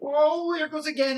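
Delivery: high startled oh, dropping off; mock dismay. Teacher acting out the spider's fall, mock dismay. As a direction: gasp at the rain washing spider down.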